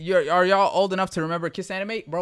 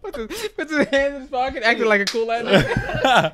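laughing; 7 semitones higher than usual. Real, not acted. Laughing while describing character.

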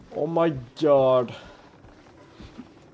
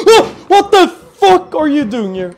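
loud, high startled shout; startled scream; 11 semitones higher, 11 dB louder than usual. Real, not acted. Gamer shrieking loud and high at a sudden enemy. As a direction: shriek in shock. Jump scare.

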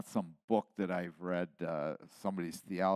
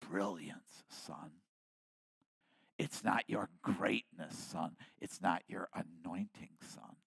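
softer, hushed words from the Lord; quiet rebuke; 2 semitones lower, 6 dB quieter than usual. Retold or imitated. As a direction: let each son fall away softly. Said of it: "Because the Lord says".

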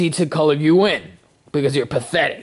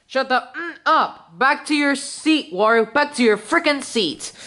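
higher-pitched irritated telling-off; exasperated scolding; 8 semitones higher than usual. Acted. Host orders Wario back to his seat, exasperated.

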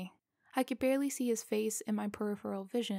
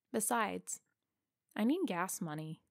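usual speaking voice

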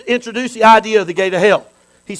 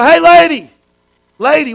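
higher, louder call across the room; 5 semitones higher, 5 dB louder than usual. Acted. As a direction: call out to her, mock hailing. Calling out to a woman.

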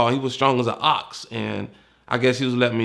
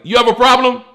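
much higher, much louder barking challenge; drill instructor yell; 12 semitones higher, 10 dB louder than usual. Retold or imitated. Lieutenant yelling in the recruit's face, barking challenge. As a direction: bark it like a drill sergeant.

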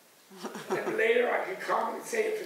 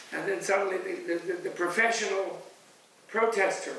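usual speaking voice

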